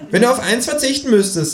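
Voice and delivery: high kangaroo voice; Känguru voice. Acted.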